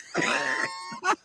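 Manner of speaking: sudden high shrieking laugh. Real, not acted. Laughing at truck flying in.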